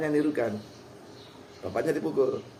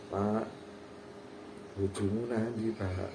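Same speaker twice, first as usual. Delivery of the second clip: low, soft, polite-sounding question; soft-mannered intonation; 6 semitones lower, 10 dB quieter, slower than usual. Acted. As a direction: ask it gently, voice lowered. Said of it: "Intonasinya hal-, halus, caranya halus". (intonasi halus, pelan dan rendah)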